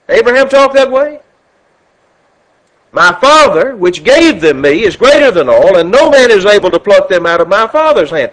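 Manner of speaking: loud, ringing sermon delivery; preacher booming a gospel reading; preaching at full force. Acted. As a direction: thunder the scripture to the congregation.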